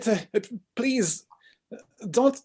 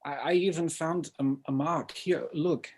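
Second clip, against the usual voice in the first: soft, hushed and troubled; quiet confession; 6 dB quieter than usual. Acted. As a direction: murmur it, ashamed and scared. Confessing his frightened story.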